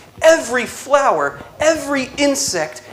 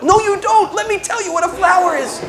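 imitating the priest; 7 semitones higher, faster than usual. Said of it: "I'm like".

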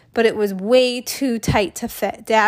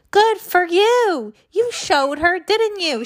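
hint of an old lady's cheer, higher, slow; cheering grandma; 9 semitones higher, 3 dB louder, slower than usual. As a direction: cheer the kid on like a spry grandma. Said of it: "she yelled".

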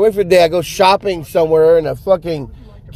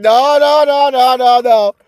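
high rapid-fire string of noes; insistent high-pitched refusal; emphatic denial; 8 semitones higher, 3 dB louder than usual. Acted. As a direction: cut off the objection, pitched high. Heads off a misquote emphatically.

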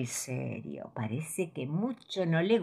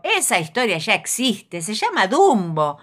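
know-it-all fox; 7 semitones higher, 7 dB louder than usual. Acted. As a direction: show off like a smug fox. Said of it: "le dice el zorro".

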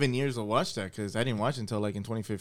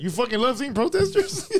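higher, laughing through the question; cracking up at the absurdity; laughing incredulous retort to a clueless friend; laughing disbelief; 9 semitones higher than usual. Retold or imitated. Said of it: "I'm like".

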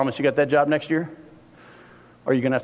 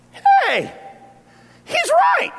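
very high squeaky "oh yeah" voice; dopey disciples' realization; 4 dB louder, much slower than usual. Retold or imitated. Preacher mimicking disciples suddenly getting God's point, very high and squeaky. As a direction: play it as a goofy, high-pitched dawning. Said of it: "hearing God say that and going".

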